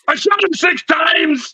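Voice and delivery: high, slow, overdone action-hero bark that misses Vader; failed Darth Vader impression. Mocking his own bad impressions to friends, high hammed bark. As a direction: ham up the same clumsy Arnold line. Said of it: "do a Darth Vader impression".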